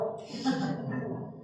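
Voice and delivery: a short, quiet laugh under the breath; brief low chuckle; soft chuckle. Real, not acted. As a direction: chuckle softly to yourself. Laughs after not understood remark.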